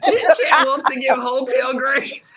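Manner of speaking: slow, laughing repeat of the line; laughing disbelief. Real, not acted. Chat repeats the comment incredulously.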